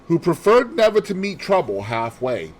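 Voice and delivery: ordinary delivery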